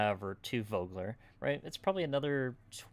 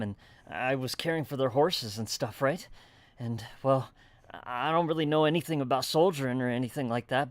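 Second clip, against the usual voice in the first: lightly voiced farmer, a bit louder and halting; shaken young farmer; 3 semitones higher, 6 dB louder than usual. Farmer telling travelers about soldiers, lightly voiced hesitant character. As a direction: explain haltingly as a rattled farmhand. Game master plays winded farmer.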